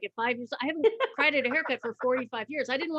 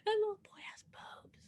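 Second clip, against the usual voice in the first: hushed, high whisper; 10 semitones higher, 9 dB quieter than usual. Retold or imitated. Kids gossiping behind her back, high whisper.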